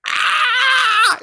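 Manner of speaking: sharp, high pained cry; pained yell. Retold or imitated. Shot man voicing his stomach, sudden high cry of pain. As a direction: let the gut pain burst out as a cry. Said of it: "my stomach was like".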